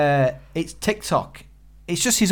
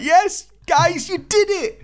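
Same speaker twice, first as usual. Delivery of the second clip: much higher, thrilled cheer; excited cheering; 4 dB louder, much slower than usual. Retold or imitated. Cheering the flying monkeys over the wall, excited. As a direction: cheer them on like a fan. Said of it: "and you're like".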